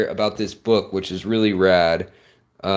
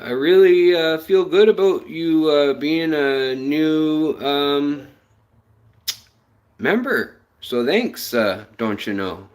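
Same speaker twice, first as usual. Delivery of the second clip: hammed-up Canadian accent, higher and louder; fake Canadian accent; 5 semitones higher, 3 dB louder than usual. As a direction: play a jokey Canadian with a mock-friendly lilt. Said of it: "sorry about the fake Canadian accent".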